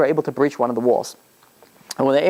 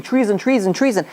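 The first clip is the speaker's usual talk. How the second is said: mild mock yell of alarm, higher pitch; retold screaming; 7 semitones higher than usual. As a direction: cry treason as a half-hearted alarm. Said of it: "people started screaming".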